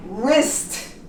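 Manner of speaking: Wrist as "rist"; silent W. Shown as a demonstration. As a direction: begin Wrist straight on R. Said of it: "We don't pronounce W".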